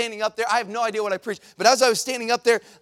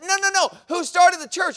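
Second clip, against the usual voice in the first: upset man, voice rising high and loud; raised voice; 9 semitones higher, 5 dB louder than usual. Retold or imitated. Stranger confronting the preacher over a tract, loud demand. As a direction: push the question harder, getting loud. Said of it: "now he's lifting his voice up".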